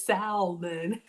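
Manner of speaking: "salmon" drawn out slowly, L sounded, through amusement. Retold or imitated. Host reading Yuchi's vote, salmon with the L. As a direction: stretch "salmon" as SAL-mun, laughing. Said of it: "If you pronounce salmon".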